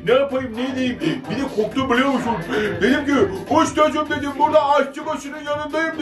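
put-on cheeky orta oyunu jester talking to kids; İblis puppet voice. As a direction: play the mischievous İblis, chatty and playful. Plays İblis for children.

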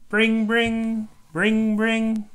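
high, loud, ringing monotone; sung-out bell sound on one held note; doorbell imitation. A narrator's reading. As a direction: ring out like a doorbell. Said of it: "the playgroup doorbell rings".

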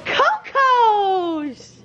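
high, drawn-out squeal of a name; long falling squeal of joy; excited greeting. Real, not acted. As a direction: call out in delighted high pitch. Greets Coco on seeing them.

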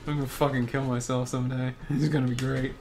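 normal talking voice

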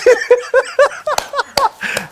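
high falsetto mock cry-laugh; mock wail. Mocking Argentine melancholy with a mock wail. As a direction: let out a shrill fake sob. Joking about crying accent.

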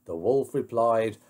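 plain reading voice

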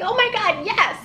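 high, squealing relief; overjoyed yes. Retold or imitated. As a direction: gasp it out like a lifeline was thrown.

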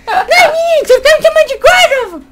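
offended character voice. Acted.

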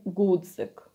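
гудзик said "gudzyk" with a firm stopped g; hard g. Shown as a demonstration. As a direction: hit the first sound of гудзик as hard g. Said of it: "you pronounce it very hardly".

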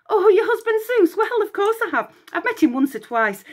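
high chattering babble; babbling. Acted. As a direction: gush innocently about Zeus. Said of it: "Echo babbled".

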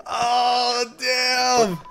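real frantic yelling, very high and loud; man down shout. Real, not acted. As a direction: yell in panic as your gunner goes down. Teammate down, player yelling.

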